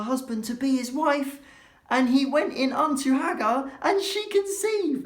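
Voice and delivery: shocked high voice, building; scandalised reading. Acted. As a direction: read it aghast.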